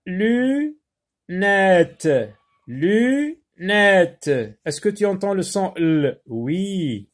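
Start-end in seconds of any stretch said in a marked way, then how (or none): 0.1-4.3 "Lunettes" higher, as lu, pause, nette; split into syllables. Shown as a demonstration. Teacher asking if pupils hear L, split into syllables. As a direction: hold lu, then give nette separately in "lunettes".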